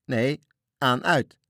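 high, loud contradicting call; confused shouting. A narrator's reading. Sailors disagree about the flashes in the dark, shouting. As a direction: shout a correction over the others. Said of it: "verward geschreeuw in het donker".

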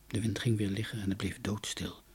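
usual speaking voice

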